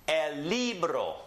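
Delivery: slow El libro, el LEE-bro; Spanish pronunciation. Shown as a demonstration. Teacher models a Spanish word, El libro slowly stressed on LI. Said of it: "Repeat after me".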